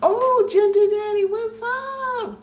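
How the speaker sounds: high, loud, delighted squeal; excited greeting. Real, not acted.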